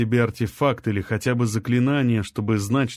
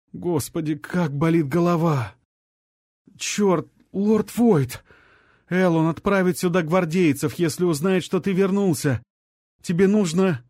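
higher pained voice, urgent and alarmed; a sick young man blurting a warning in panic; feverish young man in pain; 7 semitones higher than usual. Acted.

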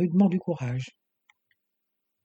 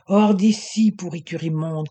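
spat in rage; 5 dB louder than usual. A narrator's reading. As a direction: spit the insult at the doctor. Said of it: "cracha-t-il, une flambée d'effroyable rage". (Teddy crache sa rage au médecin, fort et furieux)

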